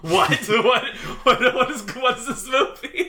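laughing. Real, not acted. Podcaster picturing listeners listening to them, laughing. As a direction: talk through helpless giggles. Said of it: "Sorry, I'm laughing".

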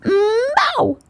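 high doggy meow; wrong meow. Acted. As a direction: meow that sounds half like a dog. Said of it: "all she could say was".

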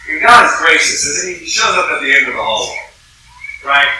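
usual speaking voice

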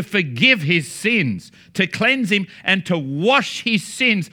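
slow, emphatic gospel preaching; earnest preaching. Acted. Preacher explaining repentance to the audience, slow and emphatic. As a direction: lean on each phrase with conviction.

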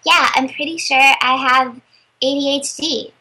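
usual speaking voice

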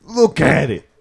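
high, loud demand; exasperated, loud insistence; insistent shout. Acted. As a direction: bark it in a Louisiana accent. Insisting guest notices the chopstick.

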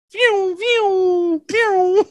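very high thin vroom noises; squeaky engine imitation; dirt bike sound. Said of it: "impersonation of a dirt bike".

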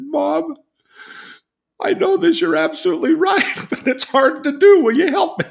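high, loud, half-laughing plea to mom; daughter's reply. Retold or imitated. Daughter admits her mother is right, high and laughing.

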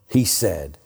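ordinary delivery

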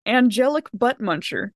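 usual speaking voice

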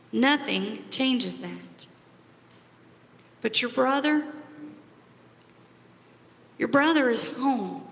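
slow, tender and pleading; gentle. Acted. Father pleading with older son to join celebration, gently. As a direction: reassure him slowly, with love. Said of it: "your father says gently".

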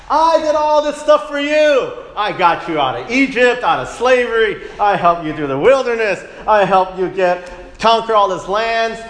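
high, loud aggrieved voice of God; God's complaint. Retold or imitated. God reproaching ungrateful Israel, loud and aggrieved. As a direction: plead as a wounded parent-God. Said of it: "That's how God felt".

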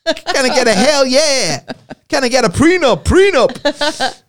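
hammed-up revival preacher proclaiming belief; mock preacher.

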